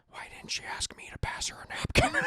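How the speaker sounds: seething whisper; hammed-up resentful whisper; mad Howard. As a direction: whisper it in mock outrage to yourself. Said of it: "He's very mad about that".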